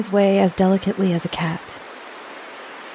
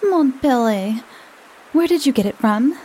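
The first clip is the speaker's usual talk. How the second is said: higher, teasing question; arch prying; 6 semitones higher than usual. A narrator's reading. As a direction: coax it out of him playfully.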